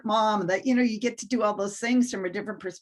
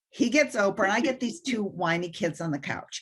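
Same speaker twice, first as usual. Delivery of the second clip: ordinary delivery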